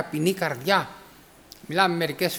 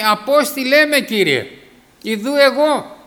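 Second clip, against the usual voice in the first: eager offering; 4 semitones higher, 5 dB louder than usual. Acted. Preacher voicing a prophet's answer.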